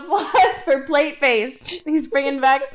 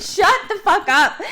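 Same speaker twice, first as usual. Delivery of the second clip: laughing; 5 semitones higher, 4 dB louder, slower than usual. Real, not acted. Laughing mock outrage.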